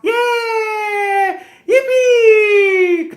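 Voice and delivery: very high, long squealed cheers; loud joyful mock squeal; imitated gleeful shrieking. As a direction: mimic children whooping with delight. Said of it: "ketawa-ketawa".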